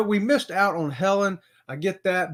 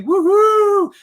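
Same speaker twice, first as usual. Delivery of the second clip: high, loud happy woohoo; excited whoop; 13 semitones higher, 9 dB louder than usual. Real, not acted. Host cheering loudly as viewer Billy joins. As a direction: cheer the new arrival.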